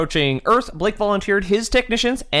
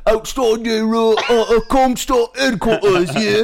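put-on Australian accent; 6 semitones higher than usual. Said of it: "who's got the best Australian accent here".

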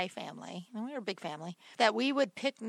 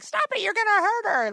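high, loud scolding mom; 11 semitones higher, 7 dB louder than usual. Retold or imitated. Retelling how mom yelled at the boys, high and loud. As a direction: snap at the boys like a worried mom. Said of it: "my mom's like".

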